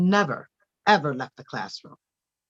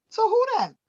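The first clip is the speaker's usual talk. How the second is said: neighbour asking. Retold or imitated. Neighbour's question voiced in story.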